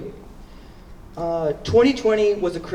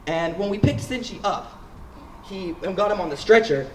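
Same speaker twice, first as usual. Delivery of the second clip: ordinary talk, nothing marked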